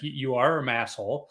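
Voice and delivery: ordinary talk, nothing marked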